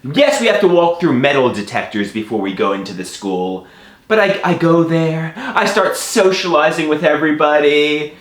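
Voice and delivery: ordinary talk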